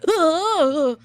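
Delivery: high, loud recoiling ugh; cringing groan. Acted. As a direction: groan as if repelled by self-praise. Recoils from praising herself.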